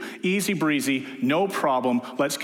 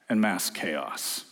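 dry punchline; 7 semitones lower, 4 dB quieter, much slower than usual. Real, not acted. Punchline aside.

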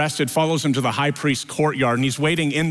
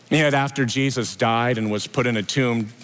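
ordinary talk, nothing marked

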